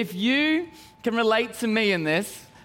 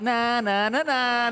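cheeky taunt; slower than usual. Retold or imitated. Child taunting his dad.

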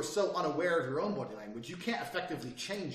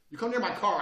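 usual speaking voice

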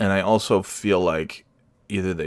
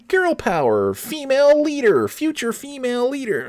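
much higher, sugary mock-cheerful slogan. Mocking kids' clothing slogans in a high sugary voice. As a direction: parody the clothing slogans in a squeaky sweet voice.

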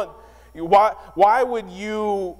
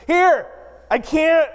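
bursting excitement; 9 semitones higher, 3 dB louder, slower than usual. Retold or imitated. Wife bursting to share surprise.

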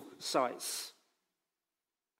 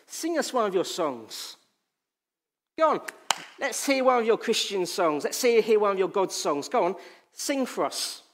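taunting, pitched up and louder; mocking captors; 5 semitones higher, 4 dB louder than usual. Captors taunting the exiled psalmist, mocking. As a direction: jeer at the captive like a tormentor. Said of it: "in a mocking way".

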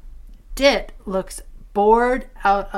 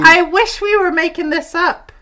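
exasperated; 8 semitones higher, 10 dB louder than usual. Real, not acted. Exasperated at absurd plot.

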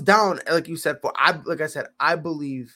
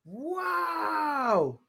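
high disbelieving cry of wow; shocked wow; 10 semitones higher, 5 dB quieter than usual. Real, not acted. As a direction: gasp it out in disbelief. Shocked at three birdies.

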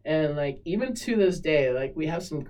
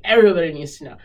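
louder, firm announcement; retold bold statement, louder; defiant declaration; 9 dB louder, much faster than usual. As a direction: declare it to everyone. Retelling own defiant declaration.

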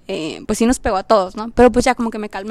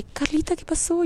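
higher, softer voice of a concerned boss; jefa preocupada; 6 semitones higher, 7 dB quieter than usual. Retold or imitated. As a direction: ask gently, pitched up, as the boss. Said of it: "Y mi jefa desde entonces de que". (jefa preguntando bajito y agudo)

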